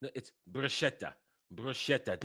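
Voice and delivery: "bruschetta" with a soft sh, broo-SHET-ta; correcting pronunciation. Shown as a demonstration. Streamer correcting a video on bruschetta, quietly correcting. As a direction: softly insist on "bruschetta" with SH in the middle. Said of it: "Not bruschetta".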